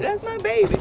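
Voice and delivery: high, loud doting cry; doting mother. Retold or imitated. Mother gushes about her grown son in a high, loud doting voice. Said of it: "I'm like".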